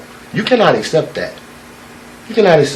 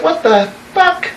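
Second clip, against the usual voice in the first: mock surprise; 12 semitones higher, 3 dB quieter than usual. Old eagle startled seeing young eagle.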